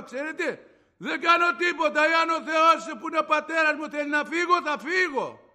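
high, very loud declaration; retold defiant faith. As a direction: proclaim trust in God at full voice.

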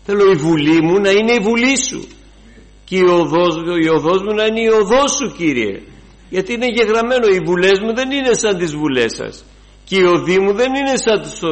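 high, loud impassioned pleading prayer; fervent prayer. Acted. Preacher prays aloud before the congregation, impassioned. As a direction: cry out to God with everything.

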